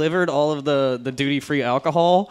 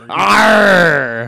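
loud, high pirate "arr"; best pirate yar; 4 semitones higher, 10 dB louder than usual. Pretend. Guest giving the host his best yar, loud pirate cry. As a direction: bellow it like a full-throated pirate. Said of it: "give us your best yar".

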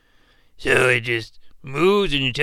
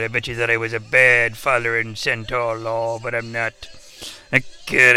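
ordinary talk

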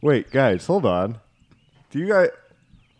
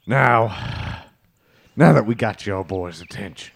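menacing rival; 6 semitones lower, 4 dB quieter than usual. Acted. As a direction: lean into the menace. Plays rival Bean Bro.

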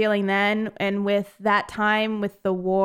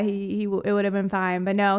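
ordinary delivery

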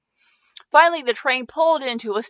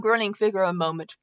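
ordinary delivery, nothing marked

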